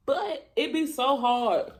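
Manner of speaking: ordinary delivery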